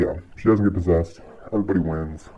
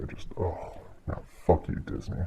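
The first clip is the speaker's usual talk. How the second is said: disgusted whisper; 8 dB quieter than usual. Real, not acted.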